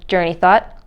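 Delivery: usual speaking voice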